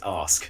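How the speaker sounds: "ask" with long vowel, S then K; correct ASK. Shown as a demonstration. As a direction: stretch "ask", ending on a clear K. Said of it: "it's A S K".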